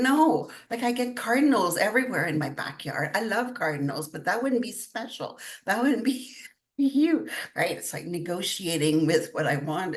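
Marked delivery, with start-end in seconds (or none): 0.0-7.3 excited protest, pitched up high, a laugh at the end; animated protest. Retold or imitated. To her dying best friend, a high laughing protest. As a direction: playfully object to the sign he offers.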